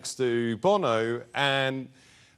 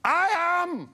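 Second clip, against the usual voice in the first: high, slow mock-heroic cry; grandiose proclamation; 13 semitones higher than usual. Imitating [name] standing up to announce himself, grandiose. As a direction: declare it to the whole room, drawn out. He stood up and he goes.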